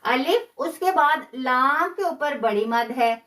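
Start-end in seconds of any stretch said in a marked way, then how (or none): 0.0-0.5 अलिफ said quick and unstretched; short alif. Shown as a demonstration. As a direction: keep अलिफ brief, don't pull it. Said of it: "खींचेंगे नहीं".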